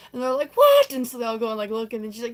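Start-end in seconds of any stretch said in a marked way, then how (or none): 0.5-0.9 very high, very loud squawk; hammed-up stunned cry; mock shock. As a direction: shriek it in disbelief. Friends shocked by the news.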